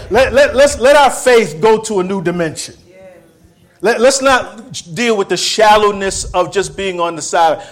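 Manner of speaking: high, loud exhortation; preaching shout. Real, not acted. Preacher urging the church into faith, loud and high.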